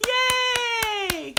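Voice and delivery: long, high, drawn-out cheer; whooping congratulations; celebratory cheer. Real, not acted. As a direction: cheer at a high pitch and hold it. Cheering for Eric's announcement.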